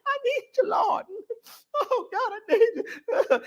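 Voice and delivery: acted wailing plea, pitched very high; hammed-up crying prayer. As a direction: play someone desperately crying out to God, sobbing in a high voice. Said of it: "crying and hollering and screaming".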